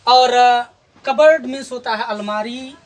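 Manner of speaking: ordinary delivery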